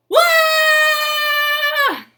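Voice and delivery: a huge, very high scream of fright; terrified scream. Acted. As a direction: shriek like you just saw a lion. Keeper screams on seeing lion.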